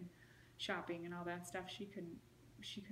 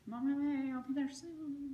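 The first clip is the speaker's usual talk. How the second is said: reassuring; 6 semitones higher, 7 dB louder than usual. Retold or imitated. Reassuring crying mother on phone.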